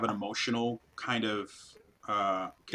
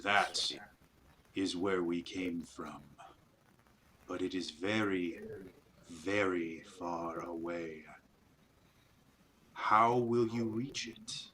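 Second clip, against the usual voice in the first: slow, hushed alien creature voice; eerie spider voice; 3 semitones lower, 5 dB quieter, slower than usual. Acted. GM voicing the spiders to the party, slow eerie creature. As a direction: speak like an ancient hive creature, slow and cold.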